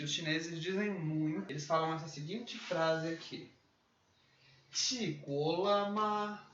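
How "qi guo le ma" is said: Qi guo le ma said slowly, Qi pitched high; Mandarin phrase. Shown as a demonstration. Teacher introducing a common Chinese greeting. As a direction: model each syllable of Qi guo le ma slowly. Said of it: "Eu posso dizer a seguinte frase".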